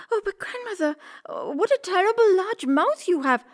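put-on very high girl voice, astonished; storybook girl's high alarm; alarmed little girl. A narrator's reading. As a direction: exclaim in a high, worried girlish voice. Girl alarmed by disguised wolf.

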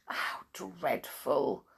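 low, drawn-out dismay; mild, slow exclamation of sympathy; dismayed cry. A narrator's reading. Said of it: "cried Mister Pinkwhistle".

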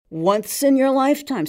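high barman voice. A narrator's reading. Barman insisting to the man on the stool, high and loud. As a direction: pitch it up and push it out.

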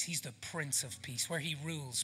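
ordinary talk, nothing marked

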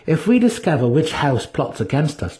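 plain reading voice

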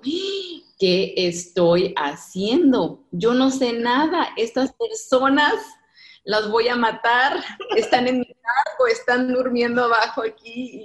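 loud, high panicked inner voice; panicked self-quote. Retold or imitated. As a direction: relive the moment of panic, half-laughing. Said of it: "que digo". (pánico recordado, más alto y fuerte)

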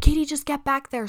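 mother urges her daughter into the back seat; urgent mom. Retold or imitated. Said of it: "my mom was like".

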